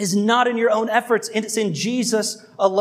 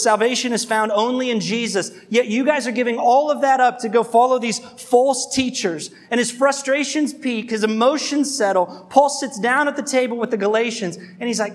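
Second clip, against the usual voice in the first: ordinary talk, nothing marked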